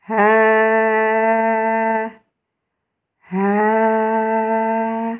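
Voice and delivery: Ha tenu longtemps, sur quatre temps; étirez le Ha longuement; Ha long. Shown as a demonstration. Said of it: "je vais prolonger". (prolongez le Ha sur quatre temps)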